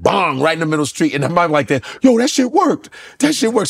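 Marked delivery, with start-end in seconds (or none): 2.0-3.7 amazed. Retold or imitated. Amazed flip worked.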